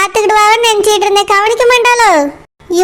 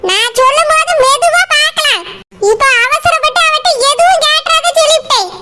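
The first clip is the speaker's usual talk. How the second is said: much higher put-on old woman's voice, scolding; shrill grandmother putting her daughter-in-law in her place; old mother-in-law; 6 semitones higher than usual. Mother-in-law scolding Saroja about a bride for Surya, high scolding old woman voice.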